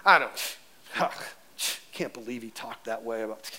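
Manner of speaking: mock muttering in the car after church; whispered complaining. Churchgoer griping after the sermon, a whispered mock mutter. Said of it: "Kinda like some of you do when you get in your car".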